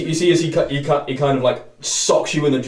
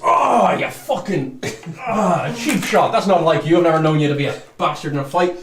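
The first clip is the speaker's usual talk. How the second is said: angry tiefling; 4 semitones higher, 4 dB louder than usual. Acted. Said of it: "you just".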